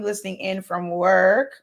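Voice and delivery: ordinary talk, nothing marked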